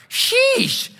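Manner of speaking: sheesh. Retold or imitated. Reacting to the huge cobra.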